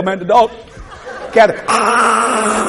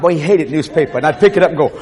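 normal talking voice

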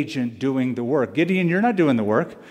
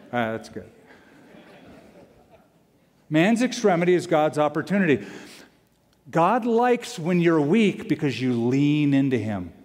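normal talking voice

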